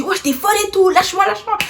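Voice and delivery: high, strained begging; mock pained boy. Boy in pain, high-pitched begging her to let go.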